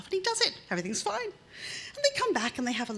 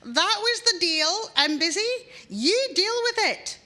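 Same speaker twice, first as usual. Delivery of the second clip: high, loud, fed-up calling from another room; wife brushing off her husband from the back room; exasperated wife; 10 semitones higher, 6 dB louder than usual. Acted. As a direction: dismiss him firmly, drawn out. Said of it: "Evie from the back room goes".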